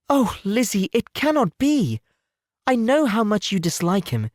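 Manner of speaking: Oh stretched, cannot be slowed down; doubting Jane. Acted. Jane doubting her sister.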